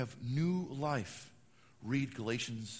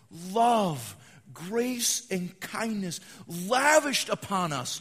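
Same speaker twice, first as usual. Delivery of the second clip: loud, slow ringing proclamation; fervent pulpit climax; preaching at full force; 3 semitones higher, 6 dB louder, much slower than usual. Acted. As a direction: lift each word of grace higher. Preaching build on grace.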